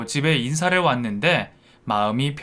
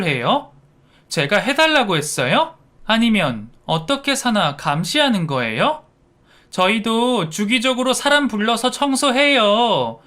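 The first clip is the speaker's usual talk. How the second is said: higher-pitched, affronted scolding; indignant daughter-in-law; 5 semitones higher than usual. Acted. Daughter-in-law telling mother-in-law off for cleaning uninvited, indignant. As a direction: tell her off with rising, incredulous questions. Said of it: "그런데 그때 며느리가".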